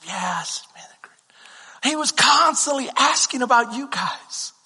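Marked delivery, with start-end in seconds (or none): 0.1-1.9 slow whispered approval. Retold or imitated. Mentor whispering that Rick and Christy are great. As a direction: whisper it slowly and warmly.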